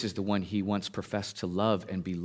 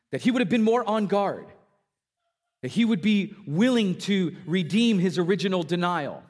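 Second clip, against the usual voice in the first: rising, louder preaching build; 10 semitones higher, 4 dB louder than usual. Acted. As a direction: drive the story home from the pulpit. Preacher presses the point.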